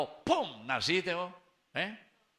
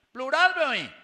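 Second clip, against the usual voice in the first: "Plural" gritado agudo y fuerte; loud; 11 semitones higher, 12 dB louder, faster than usual. Shown as a demonstration. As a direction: grita "Plural" muy alto. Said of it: "repeats word". ("Plural" a gritos)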